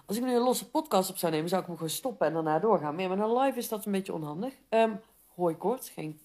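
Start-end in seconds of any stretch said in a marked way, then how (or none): none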